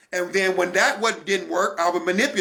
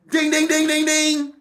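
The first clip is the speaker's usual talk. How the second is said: bell ding; 7 semitones higher than usual. Acted. Alarm bell marking the insight.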